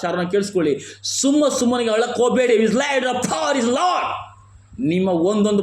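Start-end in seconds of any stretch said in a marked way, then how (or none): 1.0-4.1 high-pitched preaching shout; fervent exhortation. Real, not acted. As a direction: build to a drawn-out, high cry. Preacher exhorts the congregation at high force. (ಆವೇಶದ ಉಪದೇಶ)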